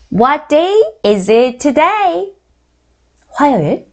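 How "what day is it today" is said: slow, drawn-out English drill; very slow model question. Shown as a demonstration. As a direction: say each word slowly for learners to repeat. Teacher models the question slowly. (또박또박 아주 천천히)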